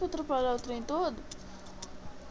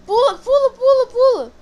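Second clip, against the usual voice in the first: high, loud frantic cheering; being chased; 9 semitones higher, 13 dB louder than usual. Real, not acted. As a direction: scream at him to jump now. Urges friend to jump.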